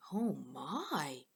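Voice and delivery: hushed worry; soft concerned whisper. Acted. Hudson concerned.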